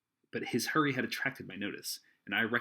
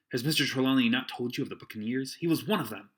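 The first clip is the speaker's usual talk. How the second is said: higher, louder eager lad; eager boy; 4 semitones higher, 7 dB louder than usual. Acted. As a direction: explain it eagerly. Jim explains eagerly.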